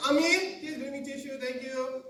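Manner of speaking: high-pitched call from the toilet; acted sing-out to someone at home; calling for help. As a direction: call out a polite request, opening loud. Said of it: "you can just call somebody".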